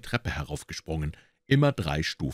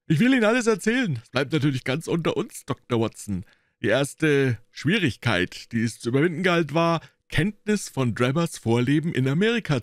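boastful detective; 8 semitones higher than usual. A narrator's reading. Gregson telling Holmes how he cracked it. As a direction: brag about your cleverness.

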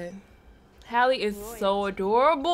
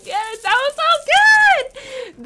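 high, slow, loud and overjoyed; stretched, squealing delight; thrilled outburst; 6 dB louder, slower than usual. Real, not acted. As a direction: gush it out slowly, high in the voice. Overjoyed after the trailer.

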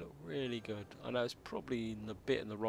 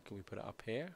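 ordinary talk

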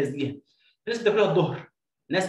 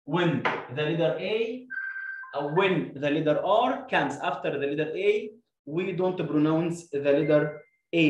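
slow rule recital; much slower than usual. Shown as a demonstration. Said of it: "قاعده مهمه جدًا".

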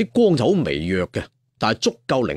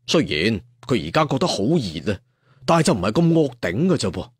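plain reading voice

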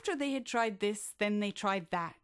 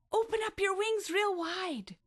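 rally him to take off; eager high call to a friend; cheering on; 11 semitones higher than usual. Acted. Shelley cheering Billy on.

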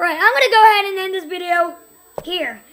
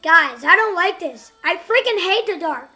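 ordinary delivery

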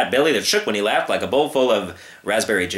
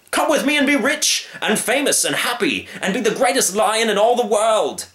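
eager showman; 11 semitones higher than usual. Acted. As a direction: sell him the dream. Circus man persuading.

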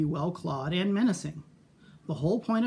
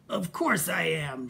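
snapped. Acted. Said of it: "she snapped".

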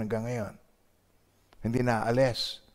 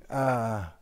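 usual speaking voice

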